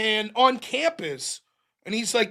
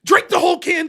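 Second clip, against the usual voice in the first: louder and higher, a hammed-up rant at himself; mock outraged yell; 7 semitones higher, 6 dB louder than usual. Adult self scolding over half-drunk soda, mock yell. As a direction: scold yourself like an angry parent.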